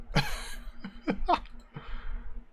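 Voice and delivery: put-on airy drunken chuckle; a wheezing gnome cackle; drunken breathy cackle. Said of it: "The drunken gnome says".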